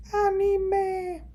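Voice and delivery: high, mock-anguished wail; mock lament for giving up anime. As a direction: wail it like a painful sacrifice. Mock anguished cry over anime.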